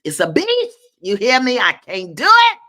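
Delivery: loud, high, fed-up rant; exasperated outburst. Real, not acted. Host complaining about the mouse, loud exasperated outburst. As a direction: let the exasperation burst out.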